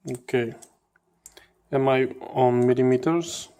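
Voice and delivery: usual speaking voice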